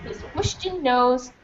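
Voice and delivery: ordinary delivery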